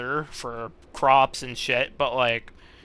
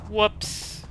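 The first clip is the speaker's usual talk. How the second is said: high playful whoops; a light, high little oops; 7 semitones higher than usual. Real, not acted. Wrong road, oops reaction.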